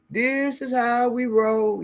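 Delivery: drawn-out mocking brag like a gloating oligarch; mock dictator swagger. Vlogger mocks dictators to viewers, slow smug boast.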